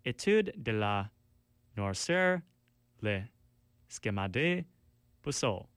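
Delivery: hammed-up anglophone French accent on the album title; a deliberately poor French accent, half joking; bad French accent. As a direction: read the French with a clumsy, self-mocking French accent. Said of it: "This is my French accent".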